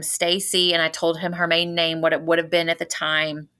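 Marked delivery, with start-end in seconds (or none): none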